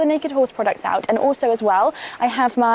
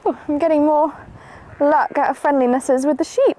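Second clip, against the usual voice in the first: ordinary talk, nothing marked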